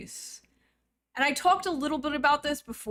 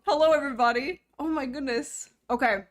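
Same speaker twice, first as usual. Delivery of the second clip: ordinary delivery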